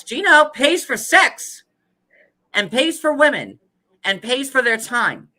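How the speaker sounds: high, slow, heavy stress on each word; emphatic. Real, not acted. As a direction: hammer out each phrase slowly. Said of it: "emphatic accusation".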